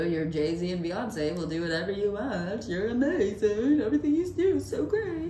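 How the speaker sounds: rising to high gushing flattery; mock fawning flattery of celebrity guests. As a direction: ham up the sucking up.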